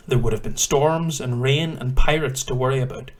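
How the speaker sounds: clear, loud storytelling read; story narration. A narrator's reading. Reads history narration aloud.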